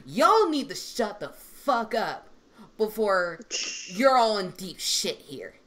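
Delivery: slow, emphatic teacher's telling-off; mock scolding. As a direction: act a mildly angry warning, word by word. Acts out scolding her students.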